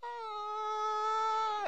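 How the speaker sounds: high, drawn-out slow-motion scream; long mock scream. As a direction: imitate one endless high wail. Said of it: "screamed so loud and long".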